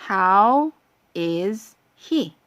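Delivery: usual speaking voice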